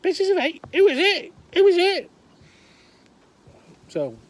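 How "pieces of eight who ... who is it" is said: piercing, very high parrot squawk. Acted. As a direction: screech it back like a mimicking parrot. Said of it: "Parrot's there going".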